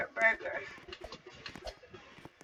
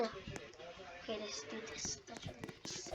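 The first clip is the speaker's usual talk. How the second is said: whisper; 5 semitones lower, 19 dB quieter than usual. Real, not acted. Whispered aside while playing.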